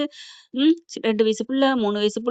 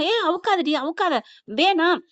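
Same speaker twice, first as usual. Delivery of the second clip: higher-pitched, acted don't-do-that; urgent protest; 5 semitones higher than usual. Hero stops her removing the shirt, urgent protest. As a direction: stop someone in a hurry, voice raised in pitch.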